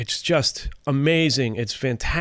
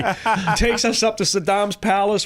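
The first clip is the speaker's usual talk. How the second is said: normal talking voice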